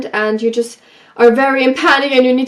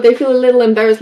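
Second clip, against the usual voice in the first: ordinary delivery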